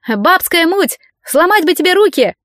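high-pitched angry jeer; angry heckle. A narrator's reading. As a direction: heckle crossly from the back of the crowd. Heckler shouting from the crowd.

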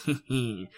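quiet evil chuckle. Acted. Villain chuckles.

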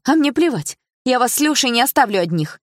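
high, loud panicky outburst; panicked defiance. A narrator's reading. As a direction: refuse to be left behind, in panic. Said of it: "выдала Дилара".

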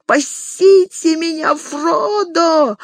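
high, frantic shout for help; high panicked yell, coughing in the water; panicked cry. A narrator's reading. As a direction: scream for rescue while drowning. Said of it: "заполошно выкрикнул Сэм".

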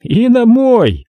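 high, loud, slow jab; cheeky cop chiming in loudly; sly cop Ramos. Acted. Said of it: "тут же добавил Рамос".

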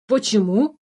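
mildly surprised question, rising at the end; sincere surprise. A narrator's reading. Changeling asks why he won't be rescued, sincerely surprised. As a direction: ask in innocent surprise. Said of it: "искренне удивился подменыш".

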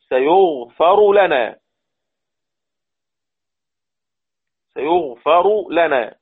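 سيغفر لنا slowed, the R dropped into a doubled L, "yaghfil-lana"; teacher demonstrating the R merged into the L; merging r into l. Shown as a demonstration. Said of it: "الراء في آخر ... تضغم".

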